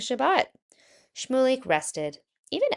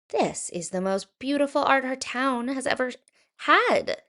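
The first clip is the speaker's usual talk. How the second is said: rising, delighted exclaim; 6 semitones higher than usual. Acted. Mayor praises the art to the crowd, delighted exclaim. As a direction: gush with growing delight. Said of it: "exclaimed the mayor".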